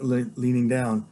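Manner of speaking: plain reading voice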